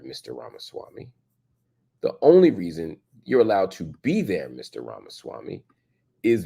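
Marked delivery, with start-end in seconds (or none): 0.0-1.1 soft, breathy direct address to the man; hushed jab at the guest; pointed hushed aside. Real, not acted. 4.5-5.6 softer, name said with a lift at the end; quiet needling call-out; pointed soft address. Real, not acted. Host addresses guest pointedly.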